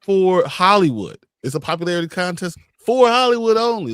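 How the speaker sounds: loud scornful emphasis; emphatic scorn. Real, not acted. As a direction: hammer the point home. Scorning the Oscars' bias.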